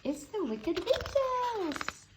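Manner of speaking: high, slow panicked cry; a mouse crying out at a looming shadow; terrified cry. A narrator's reading. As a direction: wail it up high in fright.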